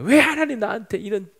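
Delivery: high outburst of grievance, dropping away at the end; mock complaint. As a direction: wail your protest to God, then let it sink. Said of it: "하나님께 원망, 불평을 늘어놓고". (원망하듯 높게 따지며)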